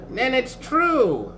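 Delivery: put-on high, slow elderly voice; old sorcerer. A narrator's reading. Old sorcerer sees the dwarf knob, slow and high. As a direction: murmur a slow, awed realisation. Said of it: "muttered the old sorcerer".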